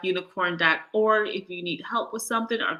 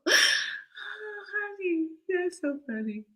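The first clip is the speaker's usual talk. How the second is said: high, soft sigh after a laughing fit; spent, amused and softly high; tickled, recovering from laughter; 11 semitones higher, 6 dB quieter, slower than usual. Real, not acted. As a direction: come down softly from a big laugh. Winding down after laughing hard.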